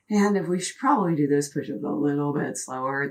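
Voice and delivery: ordinary talk, nothing marked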